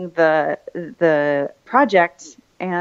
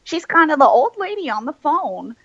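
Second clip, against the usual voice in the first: put-on high-pitched old lady voice; mock old lady, pitched way up; 10 semitones higher than usual. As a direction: slip into a high, playful old-lady impression. High voice on character's description.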